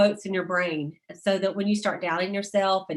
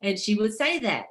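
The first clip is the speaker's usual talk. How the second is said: ordinary delivery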